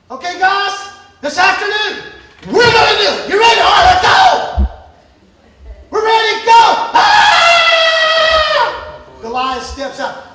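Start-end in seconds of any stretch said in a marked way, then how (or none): 0.1-6.6 loud, higher, building troop rally; pumped-up rallying. Acted. Soldiers rallying that afternoon. 6.9-8.6 long, high, loud battle scream; war cry. Acted.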